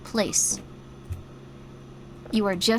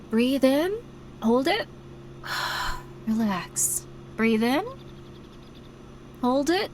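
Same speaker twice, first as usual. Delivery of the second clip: 1 guided breathing; 4 dB quieter, slower than usual. Shown as a demonstration. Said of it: "this might help a little bit".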